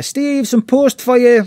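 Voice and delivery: high, loud, slow call up the stairs; imitating mum shouting from below; mum calling upstairs. Mum calling her son down for the post, mock shout. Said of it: "my mum shouts up the stairs".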